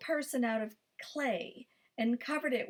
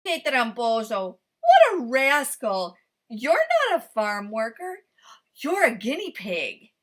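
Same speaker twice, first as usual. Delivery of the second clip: louder, accusing farmer; angry farmer; 8 dB louder than usual. A narrator's reading. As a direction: call out the trickster. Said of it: "cried the farmer".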